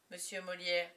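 plain reading voice

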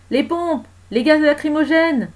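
high, loud commands, slowing to stretch the words; urgent officer's shout; shouted orders. Acted. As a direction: call orders across a crowd.

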